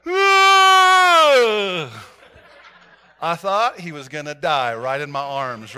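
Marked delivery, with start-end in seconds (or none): 0.1-2.1 much higher, louder startled yell; imitating a man's frightened shout; startled scream. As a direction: jump out of your skin with a cry. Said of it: "and he went".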